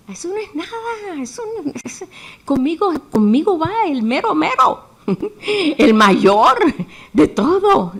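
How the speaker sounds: put-on high, cocky young David brushing off the giant; boastful young David. Young David scoffing at the giant, high and cocky. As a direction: laugh it off like a fearless boy.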